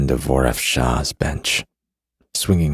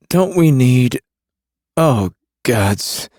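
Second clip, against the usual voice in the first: higher male voice, nauseous; a queasy smuggler; Carrion voice; 10 semitones higher, 5 dB louder, slower than usual. A narrator's reading.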